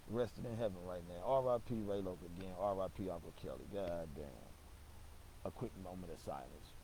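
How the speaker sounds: quiet, somber remembrance; softly honor the dead. Real, not acted. Realises both have died.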